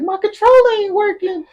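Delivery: very high-pitched imitation of a mad gamer; mock angry whine. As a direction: squeal it like a sore loser. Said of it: "He gets so mad".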